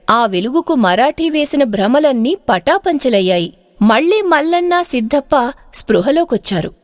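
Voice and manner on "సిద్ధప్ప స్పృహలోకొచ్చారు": ordinary delivery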